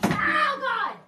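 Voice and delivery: high, loud shriek of disgust; disgusted scream. Real, not acted. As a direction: recoil from the spiders. Sees spotty spiders.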